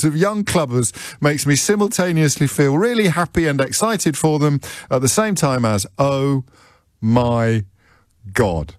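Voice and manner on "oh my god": OH, MY, GOD as separate words, dropping in pitch; quoted dismay. Retold or imitated. Host reading out professor's message, OH MY GOD in spaced-out mock dismay. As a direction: pause after each word, sinking lower to GOD. Reads out professor's exasperated reaction.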